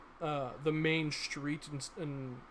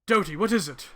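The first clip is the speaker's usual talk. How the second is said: loud call from inside; 3 semitones higher, 9 dB louder, faster than usual. Acted. Injured man calls from inside.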